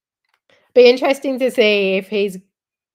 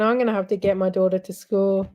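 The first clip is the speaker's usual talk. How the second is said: ordinary talk, nothing marked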